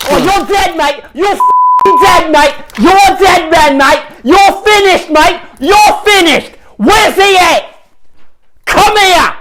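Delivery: enraged English hooligan yelling; furious mock threat. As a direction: come at him swinging. English guest threatening fight.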